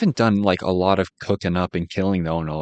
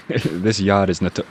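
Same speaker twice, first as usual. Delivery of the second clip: slow Boston drawl on yard; accidental Boston vowels, yahd; Boston accent; 3 dB louder, slower than usual. Real, not acted. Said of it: "My Boston accent just came out".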